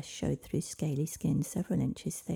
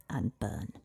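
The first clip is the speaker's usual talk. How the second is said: solemn motto; 8 semitones lower than usual. Acted. Dragon ends its solemn saying.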